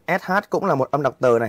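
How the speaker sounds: ordinary talk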